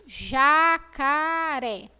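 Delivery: JACARÉ very slow, each syllable held long; long open É ending Jacaré; drawn-out choral model. Shown as a demonstration. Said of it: "vamos falar todo mundo junto".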